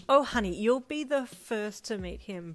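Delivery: loud, gushing promise; excited. A narrator's reading. Friend promises a first meeting with the puppy, excited. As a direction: gush it to a dear friend. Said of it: "Brian said excitedly".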